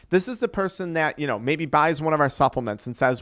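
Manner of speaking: ordinary talk, nothing marked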